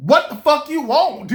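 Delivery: finally losing patience; irritated outburst. Retold or imitated. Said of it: "I'm just being real".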